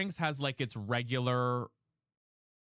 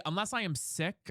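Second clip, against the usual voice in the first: ordinary delivery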